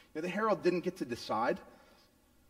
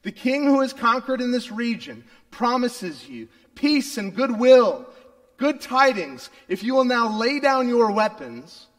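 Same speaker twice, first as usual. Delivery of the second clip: loud, high town crier call; herald's proclamation; 6 semitones higher, 8 dB louder, slower than usual. Retold or imitated. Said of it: "but it's like".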